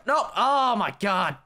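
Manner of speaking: gamer wailing at a missed jump; exasperated. Real, not acted. Gamer missing a jump on parkour, exasperated.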